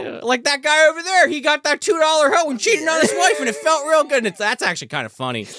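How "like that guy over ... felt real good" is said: higher-pitched impromptu sermon delivery; mock preacher. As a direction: call out a sinner like a hammed-up preacher. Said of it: "the whole sermon is about".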